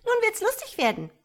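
very high, fast, loud tadpole voice; little creatures calling out. A narrator's reading. Tadpoles look forward to teasing the frog, high calling. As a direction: call it out high and eager for mischief. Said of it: "riefen die Tierchen im Wasser".

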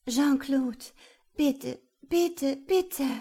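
high, loud, urgent plea; begging. A narrator's reading. Woman pleads with Jean-Claude, begging desperately. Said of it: "Ach, du kannst betteln".

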